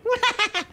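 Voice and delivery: sudden high cackle; high-pitched demonstrated laughing fit; losing it laughing. Shown as a demonstration. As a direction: burst out in a demonstration of breaking. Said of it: "losing it in the fucking".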